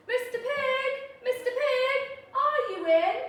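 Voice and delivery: high, loud sugary call; polite calling. Retold or imitated. Wolf calls at the stick house, loud and sugary. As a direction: call up to the door all honey. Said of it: "So I called".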